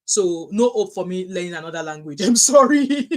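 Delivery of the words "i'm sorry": higher, louder sorry through laughter; laughing apology. Real, not acted. Laughing apology about languages.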